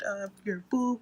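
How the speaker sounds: talking through a yawn; words stretched by a yawn. Real, not acted.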